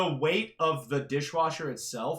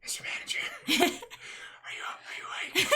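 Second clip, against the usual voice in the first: soft unvoiced whisper at the door; 12 semitones higher, 5 dB quieter than usual. Friend whispers at the bedroom door at night, hushed. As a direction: whisper through a door so as not to wake anyone. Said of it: "I'll do some Foley work".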